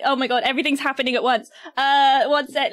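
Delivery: ordinary talk, nothing marked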